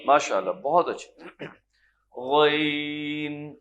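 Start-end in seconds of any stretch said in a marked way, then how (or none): 2.2-3.5 "غین" slow and rolling from the back of the throat; slow full-mouthed "غین"; full ghain. Shown as a demonstration. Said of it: "غین تو آسان ہے نا".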